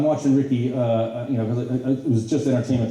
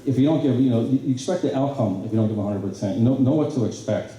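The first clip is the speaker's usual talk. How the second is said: ordinary talk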